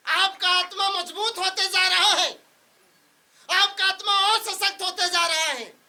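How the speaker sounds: high, loud preaching proclamation; full-force preaching. Real, not acted. Pastor declaring strength over the congregation, full-force preaching.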